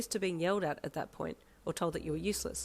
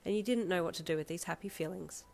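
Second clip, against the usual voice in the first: usual speaking voice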